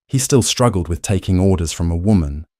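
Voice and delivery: ordinary delivery, nothing marked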